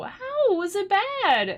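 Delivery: high, slow voiced viewer question; imagined listener voice. Answering viewer questions, high puzzled voice.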